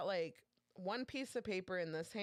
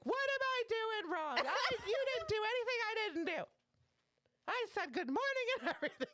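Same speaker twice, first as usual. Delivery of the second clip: much higher and louder, indignant outburst; livid coworker; 3 dB louder than usual. Retold or imitated. Coworker furious at the copier, much higher and louder. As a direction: demand to know what you did wrong. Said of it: "She was fucking livid".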